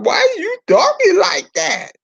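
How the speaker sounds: mocking listener. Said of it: "I know a lot of y'all".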